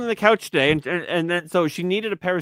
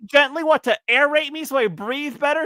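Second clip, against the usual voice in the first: mock incredulous; 9 semitones higher than usual. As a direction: pitch it up in exaggerated disbelief. Acted disbelief at wife's excuse.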